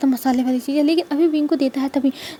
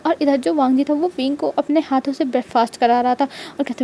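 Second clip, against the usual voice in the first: plain reading voice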